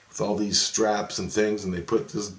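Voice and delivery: usual speaking voice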